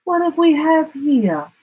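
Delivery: a graceful lady emu asking. A narrator's reading. Emu voiced, high.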